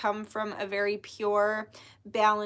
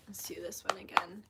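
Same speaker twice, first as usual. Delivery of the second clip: whispering; 3 semitones lower, 8 dB quieter than usual. Real, not acted. Aside while shuffling cards.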